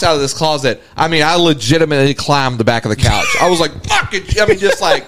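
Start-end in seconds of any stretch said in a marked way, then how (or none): 3.9-4.4 a high startled yelp; startled curse. Retold or imitated. Retelling a jump scare to a friend, high startled curse. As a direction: blurt it out in fright, way up high.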